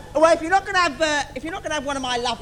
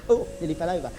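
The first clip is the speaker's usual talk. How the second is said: usual speaking voice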